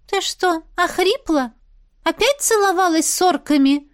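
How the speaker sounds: high accusing fairy question. A narrator's reading. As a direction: accuse her, high and sharp. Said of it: "обвиняюще вопросила Чистяна".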